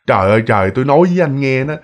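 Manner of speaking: ordinary talk, nothing marked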